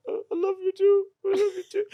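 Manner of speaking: very high, crying voice; tearful reply. Retold or imitated. Younger self answering his older self, crying high. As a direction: choke out the words through tears.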